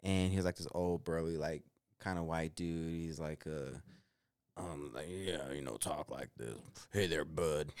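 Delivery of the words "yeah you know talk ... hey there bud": put-on lower, softer old smoker's voice; imitating coworker Mike. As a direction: do the burly old chain smoker, low and easy. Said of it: "he spoke like that".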